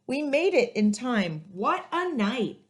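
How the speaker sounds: put-on lively read-aloud to children, rhymes bouncing; story narration. A narrator's reading. Librarian reading a picture book to kids, lively and rhythmic. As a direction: storytime voice for young listeners.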